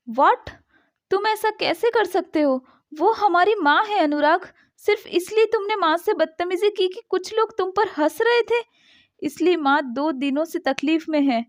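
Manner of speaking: stunned, then scolding her husband; shocked. A narrator's reading. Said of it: "प्रेरणा चौंक कर कहती है". (चौंककर, ऊँची आवाज़ में डाँटते हुए)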